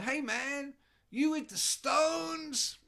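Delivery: hammed-up dealer hailing a rocker; stoned dealer. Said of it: "he goes".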